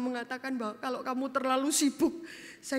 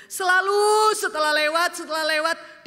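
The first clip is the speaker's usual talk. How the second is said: preaching climax; 5 semitones higher, 8 dB louder than usual. Acted.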